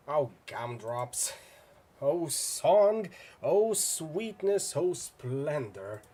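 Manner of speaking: slow, drawn-out hammy delight; savoring delight. Acted. Villain delights in the coming showdown, slow relish. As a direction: relish each word like a treat.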